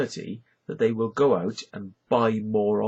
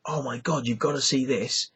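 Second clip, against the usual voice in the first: lightly acted, higher-pitched eager friend; mock excited urging; 4 semitones higher than usual. Friends urge him to watch a video, mock excited. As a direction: imitate a kid pushing a video on a mate.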